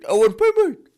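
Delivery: high, loud angry outburst; angry demand. Acted. Lender angrily scolds the debtor who hasn't paid. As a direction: snap at the late payer.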